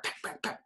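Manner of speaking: breathy woodpecker pecks; whispered pecking. Retold or imitated. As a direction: tap it out like a woodpecker.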